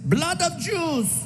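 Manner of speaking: slow, high, deliberate punchline; slowly delivered shock line; punchline in his face. Retold or imitated. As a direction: lean in and land it slowly. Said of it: "got right in his face".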